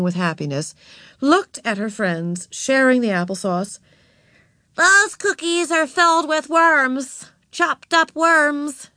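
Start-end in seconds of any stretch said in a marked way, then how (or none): 4.8-8.9 put-on high, drawn-out child's voice; gleeful little girl, high and slow. A narrator's reading. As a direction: relish each word slowly and gleefully. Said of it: "she gleefully told everyone".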